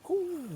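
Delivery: high, soft ooh; impressed ooh. Real, not acted. Cook watching water go in, impressed high ooh.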